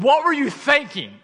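high, loud exasperated yell, acting out the moment; lost-it outburst. Dad retelling how he snapped at his daughter over syrup, mock outraged yell. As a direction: replay losing your temper, pitch shooting up. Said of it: "I actually lost my mind".

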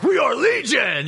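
slow, high, booming mock demon. Pastor jokingly voices the demon, slow and high. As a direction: declare it like a grand demon host.

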